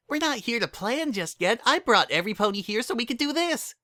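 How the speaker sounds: high, fast, breathless chatter; excited Pinkie. Acted. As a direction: gush it out in one excited rush.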